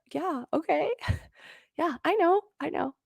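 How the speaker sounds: high, giggly yeah okay, I know; laughing dismissal. Retold or imitated. Answering her coach's repeated words, laughing and high. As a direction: wave it off with a nervous laugh.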